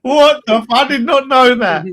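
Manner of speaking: high, loud burst of laughing disbelief; real astonished laughter, voice up high. Real, not acted. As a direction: crack up, shocked and delighted. Stunned by the prison football story.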